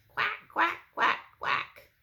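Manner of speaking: high, loud duck quacking; Mama Duck voice. Acted. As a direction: call out like a mother duck. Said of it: "So Mama Duck said".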